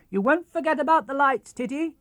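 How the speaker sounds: captain calling back to Titty. Acted. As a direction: call back to the shore from the boat. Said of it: "he called".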